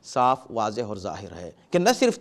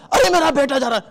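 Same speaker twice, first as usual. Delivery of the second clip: alarmed parent; 7 semitones higher, faster than usual. Pretend. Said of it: "آپ کی کیفیت تو عجیب کیفیت ہے".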